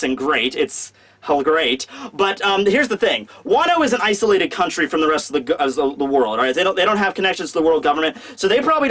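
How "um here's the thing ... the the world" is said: usual speaking voice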